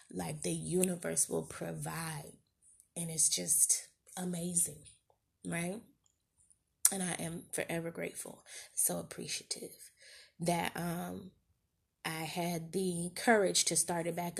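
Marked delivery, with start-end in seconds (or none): 0.1-9.9 hushed, close to real tears; soft and overcome, keeping the voice from cracking; getting emotional. Real, not acted. Grateful over her first paralegal client, getting emotional. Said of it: "my voice was about to start cracking".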